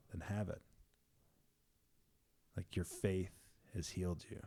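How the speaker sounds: soft, understated quote with pauses; gently voiced pronouncement; quiet, calm reply. Jesus's reply voiced in retelling.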